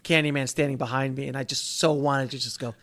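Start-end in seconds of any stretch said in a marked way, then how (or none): none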